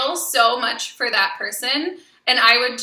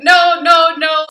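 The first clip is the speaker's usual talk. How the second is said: emphatic no; 5 semitones higher, 8 dB louder, slower than usual. Retold or imitated. Emphatic refusal of client.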